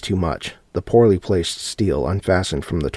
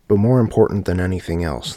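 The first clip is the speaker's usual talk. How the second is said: ordinary delivery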